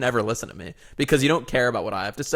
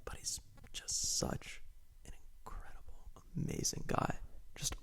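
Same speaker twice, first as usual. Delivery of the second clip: low-level mock-reverent whisper; barely audible whisper; 2 semitones higher, 21 dB quieter than usual. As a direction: whisper the praise, almost inaudible. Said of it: "Your levels were kinda low there".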